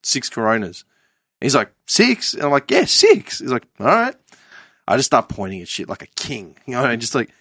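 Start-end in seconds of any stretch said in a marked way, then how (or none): 1.9-2.3 high questioning waiter; mock waiter. The waiter checking the order, high and puzzled. 2.7-3.4 high loud eager confirm; mock eager. Ordering six beers from the waiter, very high and loud. 3.8-4.1 high chirpy waiter reply; mock waiter. The waiter accepting the order, high.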